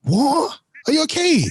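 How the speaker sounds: high-pitched baffled questions; incredulous. Real, not acted. Co-host reacting to Trump's claim, incredulous. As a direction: shoot the pitch right up in disbelief. Mock disbelief at the Trump clip.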